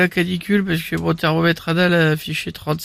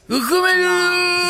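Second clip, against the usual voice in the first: high, slow cooing; Loana impression; 11 semitones higher, slower than usual. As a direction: coo it sweetly and slowly. Imitation de Loana. (voix de Loana aiguë et lente)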